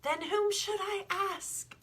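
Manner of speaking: high, drawn-out wondering question; young boy, puzzled and slow. Acted. The boy asks the sun who else could help, slow and high. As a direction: ask it slowly, lost.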